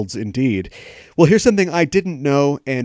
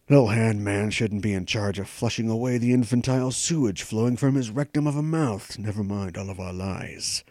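put-on Jason Isaacs' Liverpool accent; 2 semitones lower, 4 dB quieter than usual. Host reading Isaacs' tweet in his Liverpool accent. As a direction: read it like a Scouser, lips barely moving. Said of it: "should I do the accent?".

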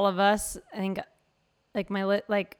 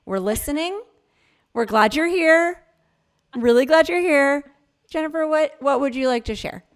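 warm welcome; 6 semitones higher, 4 dB louder than usual. Real, not acted. Welcoming inner child as guest.

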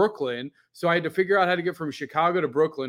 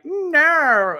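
mock loud no; 12 semitones higher, 7 dB louder than usual. Friends' retold reply.

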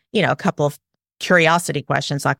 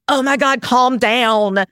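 higher, louder dismissive oh my God calm down; exasperated calm down; 5 semitones higher, 3 dB louder than usual. Retold or imitated. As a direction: brush off the upset with a sharp calm down. Mocking parent telling kid calm down.

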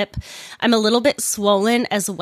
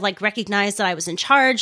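ordinary delivery

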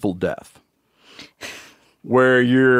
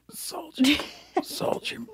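high, fading whisper of a dying man; soft echoing dream voice; 12 dB quieter, much slower than usual. Pretend. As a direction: breathe it out as you fade away. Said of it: "can you do it as you die for me".

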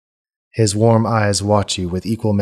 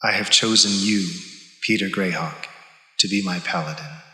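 a softer, measured divine calm; cool goddess voice; 4 dB quieter than usual. Acted. Goddess passing judgement on her paladin, cool and soft. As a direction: speak as a serene, distant goddess. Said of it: "Visham's cool voice comes to you".

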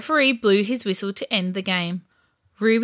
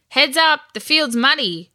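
raised, higher warning call; shouted warning; 6 semitones higher, 5 dB louder than usual. A narrator's reading. Sandy warns Ruby on the muddy field, shouting. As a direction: shout a heads-up to a teammate. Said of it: "Sandy shouted".

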